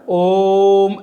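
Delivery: ओम held long and loud, the ओ stretched; prolonged Om. Shown as a demonstration. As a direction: chant ओम with a long held vowel. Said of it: "ऐसे करते थे।".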